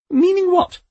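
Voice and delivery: sharp, high and loud demand; old woman demanding Death explain, loud and high. A narrator's reading. Poldy demands answer.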